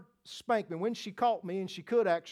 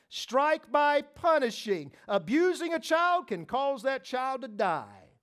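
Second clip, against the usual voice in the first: higher, louder emphatic preaching; preaching at full force; 6 semitones higher, 4 dB louder than usual. Acted. Preacher driving home punishment versus abuse, forceful. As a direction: hammer the point home.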